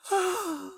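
long, high gasp of shock; fainting gasp. A narrator's reading. Girl gasps and faints.

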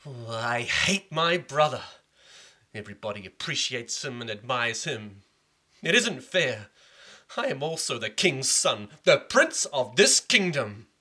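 jealous prince fuming loudly at the unfairness; furious prince. Acted. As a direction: spit out his jealousy. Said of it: "Rama Gupta became furious".

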